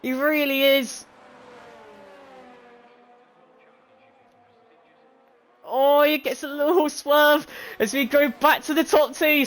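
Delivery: high-energy live play-by-play; excited race commentary. Acted. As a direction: call the race finish live.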